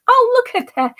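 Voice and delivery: high-pitched, feigned amazement; mock surprise. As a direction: act astonished at a sarcastic discovery. Mock discovery of riots in Houston.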